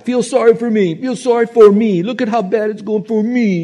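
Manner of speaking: high, loud whining victim voice; mock the self-pitying whine; whiny self-pity. Preacher mocking a self-pitying victim, high whining. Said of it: "there's an element of pride".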